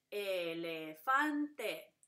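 "Elefante" very slowly, beat by beat; "Elefante" divided slowly, loud on "fan"; split into syllables. Shown as a demonstration. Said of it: "dividimos la vocal sola".

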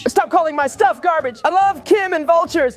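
high-pitched tearful wail; crying. Acted. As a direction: shriek the protest through tears. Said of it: "stop crying about your garbage".

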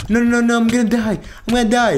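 high-pitched, rapid-fire panic; frantic, certain the end is coming; panicked. Real, not acted. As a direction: blurt it out in rising alarm. Panicking during game danger.

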